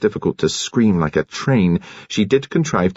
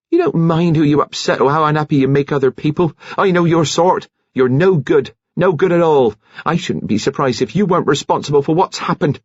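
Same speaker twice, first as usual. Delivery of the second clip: high-pitched indignant telling-off; scolding; 8 semitones higher than usual. A narrator's reading. Nurse accuses the woman of leading men on, scolding. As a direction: scold her in rising indignation.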